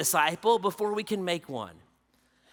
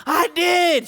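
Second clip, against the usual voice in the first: put-on excited girl; 9 semitones higher, 7 dB louder than usual. Daughter voiced in story.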